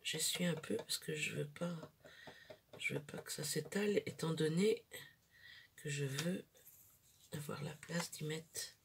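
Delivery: talking very softly, hushed; library whisper. Real, not acted. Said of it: "je parle tout bas". (voix très basse, chuchotée)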